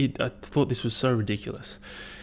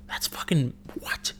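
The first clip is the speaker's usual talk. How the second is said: whispered aside; 4 semitones higher, 6 dB quieter than usual. Real, not acted. Baffled aside.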